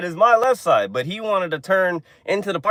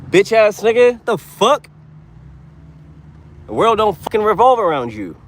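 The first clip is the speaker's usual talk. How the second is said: raised, louder cursing at a bad driver; angry rant; 6 semitones higher, 4 dB louder than usual. Real, not acted. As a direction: chew out the other driver, voice up high.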